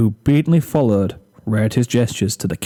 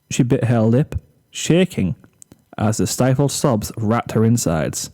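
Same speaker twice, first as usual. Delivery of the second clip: ordinary delivery, nothing marked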